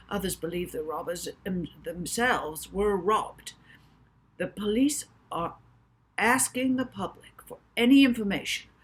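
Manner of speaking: breathless breaking-news reporter under an umbrella; excited TV reporter. A narrator's reading. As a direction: report it live to camera, thrilled by the story. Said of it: "The reporter continued".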